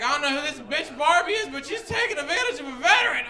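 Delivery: high, loud, indignant mock rant; outraged grandfather. Grandfather ranting about Barbie in store, mock indignation. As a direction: complain loudly as a wronged old veteran. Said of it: "my grandfather loses his shit".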